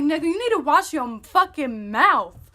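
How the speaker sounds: loud, high-pitched telling-off; re-enacted outraged retort; angry warning. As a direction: warn him off forcefully. Warning boyfriend who insulted sister.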